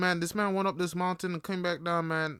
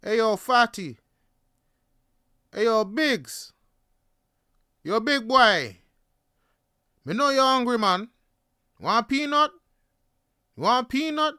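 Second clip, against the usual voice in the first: raised, higher street-vendor call; yelled taunts; 6 semitones higher, 6 dB louder than usual. Retold or imitated. Street seller heckling a hungry passer-by, imitated yelling. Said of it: "And he's yelling".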